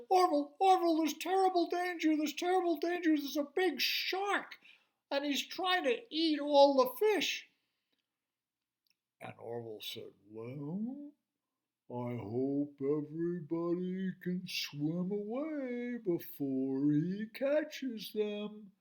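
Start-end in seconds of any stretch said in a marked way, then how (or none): 0.1-7.5 high, loud, urgent alarm; shrill frightened cry of danger; panicked little fish. Acted. Frightened fish warns of shark. 10.3-18.7 put-on unhurried, steady old turtle; calm slow reply. Calm turtle answers slowly.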